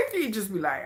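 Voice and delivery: usual speaking voice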